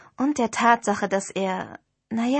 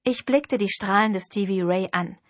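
usual speaking voice

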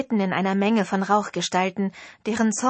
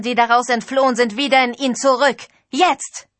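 louder and higher, a ritual command; commanding call; 4 semitones higher, 6 dB louder than usual. Acted. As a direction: order the elements with full authority. Priestess commands the spirits.

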